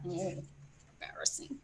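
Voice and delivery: soft unvoiced aside; whispered aside. Real, not acted.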